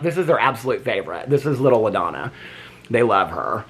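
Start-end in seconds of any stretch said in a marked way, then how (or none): none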